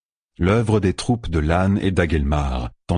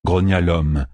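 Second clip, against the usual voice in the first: ordinary delivery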